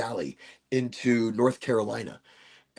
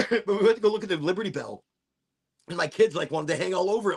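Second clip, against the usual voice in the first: normal talking voice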